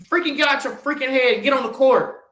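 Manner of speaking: usual speaking voice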